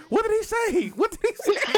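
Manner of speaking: high, loud baffled squeal; confused bystander. Retold or imitated. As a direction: squeak it out, totally lost and confused. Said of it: "hear the other person just like".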